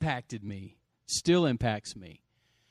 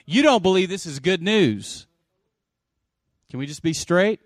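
loud, raised preaching voice; forceful preaching; 7 semitones higher, 12 dB louder than usual. Real, not acted. Preacher challenges congregation on good news, forceful preaching. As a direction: drive it home to the congregation.

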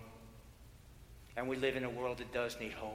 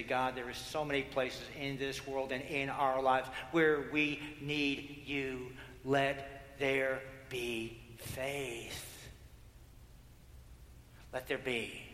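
prayer; slower than usual. Acted. Preacher voices a prayer.

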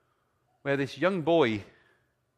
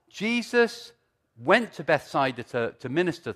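preaching; 4 semitones higher, 6 dB louder than usual. Acted. Preaching at full force.

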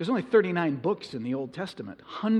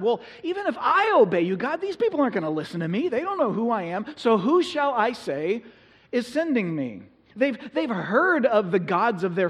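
much higher, pleading Moses voice; whiny Moses; 7 semitones higher, 6 dB louder than usual. Retold or imitated. Moses arguing with God from the bush, high and pleading. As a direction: play Moses haggling, pitched way up.

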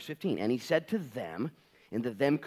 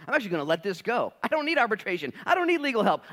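rising louder, higher, faster; building conviction; 12 semitones higher, 9 dB louder, faster than usual. Acted. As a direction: grow in confidence to a shout. Rising declaration of faith.

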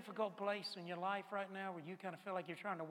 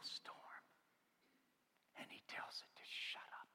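breathless hushed telling; awed whisper; 2 semitones lower, 14 dB quieter than usual. Acted. Peter telling John Mark what Jesus did, in an awed whisper. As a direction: whisper it like a secret wonder.

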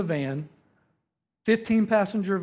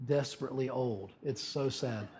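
mock pity; 4 semitones lower, 12 dB quieter than usual. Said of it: "others of you are going".

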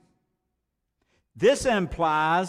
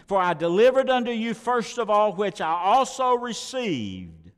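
lifted, formal reading voice; a preacher reading Paul aloud; scripture reading; 3 semitones higher than usual. Acted. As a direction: read the verse as holy text.

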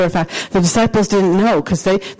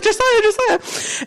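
laughing call; 12 semitones higher, slower than usual. Retold or imitated. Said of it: "I was like".

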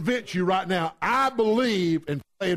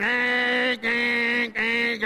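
imitated long nasal honking; car horn honks; 3 semitones higher, much slower than usual. Husband in the car blowing the horn, imitated honks. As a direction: blare like an impatient car horn. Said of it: "Blowing the horn".

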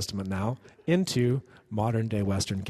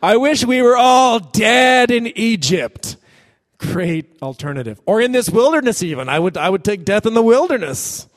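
wailing complaint; 8 semitones higher, 8 dB louder than usual. Acted. Spies wailing, acted.

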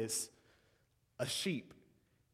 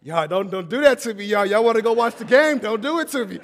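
David pleading; 5 semitones higher, 11 dB louder than usual. Acted. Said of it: "Then he says".

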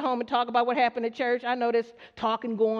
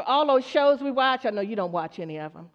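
ordinary talk

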